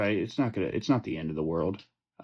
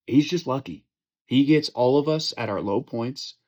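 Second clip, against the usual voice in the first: raised, heated ranting at the league; fired-up trash-talk rant; mock angry rant; 3 dB louder than usual. Real, not acted. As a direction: chew out your buddies, loud and fed up. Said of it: "I'm just gonna go on a rant here".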